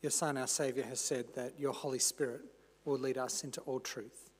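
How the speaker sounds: low, hushed prayer voice; opening prayer. Real, not acted. Preacher praying to the Father before the sermon, reverent prayer. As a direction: pray softly and low, pausing between phrases.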